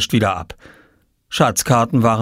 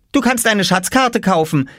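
put-on higher cheeky child voice; offering; 11 semitones higher than usual. A narrator's reading. Oskar bargaining with Jule, cheeky. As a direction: offer it coolly. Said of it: "schlug Oskar vor".

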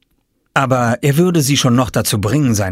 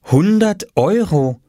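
higher, slow, drawn-out read; reading the sign; 9 semitones higher, 3 dB louder, slower than usual. A narrator's reading. Mama reading Oskar's sign, amazed. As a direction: spell it out slowly from the sign. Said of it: "las Mama".

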